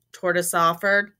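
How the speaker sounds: usual speaking voice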